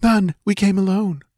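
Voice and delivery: high, meek female character voice; frightened dancing girl. A narrator's reading. Captive girl answering Conan, frightened. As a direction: answer timidly, high-pitched.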